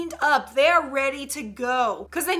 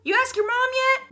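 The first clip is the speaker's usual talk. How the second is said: put-on childhood friend; 10 semitones higher than usual. Childhood friend asking about mom.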